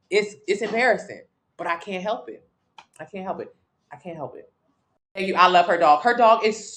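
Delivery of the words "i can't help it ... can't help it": fading. Real, not acted. Repeats fading away.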